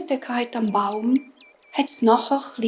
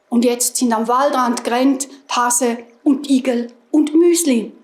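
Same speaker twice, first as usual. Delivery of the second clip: ordinary delivery, nothing marked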